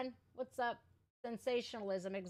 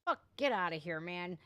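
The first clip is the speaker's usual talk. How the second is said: ordinary talk, nothing marked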